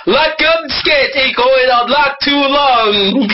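high, slow fake-French complaint; mock French. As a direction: drag English out in a comic French accent. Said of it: "Sorry, I mean".

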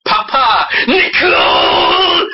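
broken French, ending in a drawn-out cry; hammed-up French plea; mock French accent. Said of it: "He's like".

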